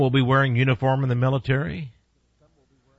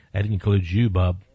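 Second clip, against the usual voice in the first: usual speaking voice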